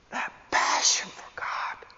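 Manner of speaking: hushed, fervent whisper; whispered with quiet conviction; intense whisper. Real, not acted. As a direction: drop to a reverent whisper.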